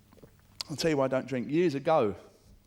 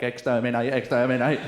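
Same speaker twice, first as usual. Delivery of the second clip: a lightly done, louder Dalek chant; 7 dB louder than usual. Retold or imitated. Comic comparing a teenage boy to a Dalek, light robotic chant. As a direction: bark it like a Dalek, only half in voice. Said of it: "It's like a Dalek".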